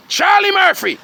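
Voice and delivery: mock yell of "Charlie Murphy" in the sketch style; Chappelle's Charlie Murphy yell. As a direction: belt the name like the famous sketch. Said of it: "Dave Chappelle when Dave Chappelle was doing that".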